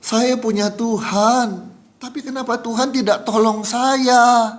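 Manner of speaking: slow, high, imploring imitation; retold tearful lament. Fire victim asking why God did not help, retold tearful lament. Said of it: "sambil nangis".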